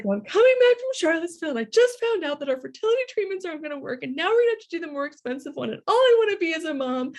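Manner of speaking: high, loud, rushed tearful outpouring; re-enacted meltdown, words tumbling out; imitated sobbing rant. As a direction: act out blurting it all hysterically. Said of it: "like, sobbing uncontrollably".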